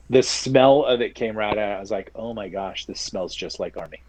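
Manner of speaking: ordinary delivery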